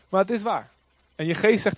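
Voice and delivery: normal talking voice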